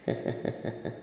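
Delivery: a soft, whispered villain laugh; breathy evil chuckle. Acted. Villain relishing his new scheme, soft breathy chuckle.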